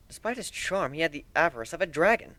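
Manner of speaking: ordinary delivery, nothing marked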